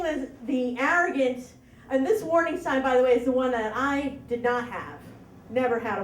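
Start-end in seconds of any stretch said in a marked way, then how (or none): none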